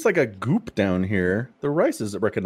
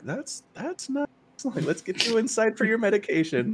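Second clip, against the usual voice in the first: caregiver voice; 12 semitones higher than usual. Retold or imitated. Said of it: "the person pushing the wheelchair is always like".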